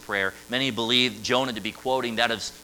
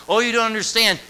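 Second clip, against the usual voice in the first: insistent protest; 11 semitones higher, 8 dB louder than usual. Acted. Acted protest of a grieving individual.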